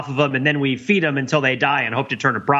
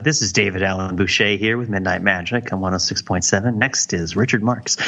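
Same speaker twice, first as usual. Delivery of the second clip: radio DJ voice; 7 semitones lower than usual. Pretend. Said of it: "figure out a radio voice".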